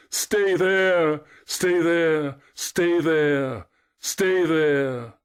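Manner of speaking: high, loud wailing echo; long plaintive calls, gradually falling; weeping cry. A narrator's reading. Echo cries back to Narcissus, wailing high. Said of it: "weeping to utter it".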